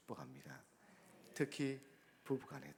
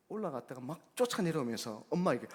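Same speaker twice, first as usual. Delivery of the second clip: ordinary delivery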